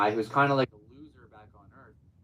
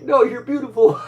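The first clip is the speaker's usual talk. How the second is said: high, loud mock shout; shouted quote; 13 semitones higher, 11 dB louder than usual. Telling about a weird dream, shouted at full volume. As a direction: blurt it out, shouting. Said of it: "And I was like".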